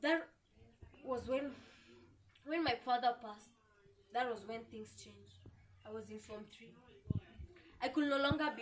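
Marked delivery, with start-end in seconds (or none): 0.0-6.7 soft, sinking voice, pausing over the loss; subdued grief. Acted. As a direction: let it fade almost to nothing. Recalling her father's death.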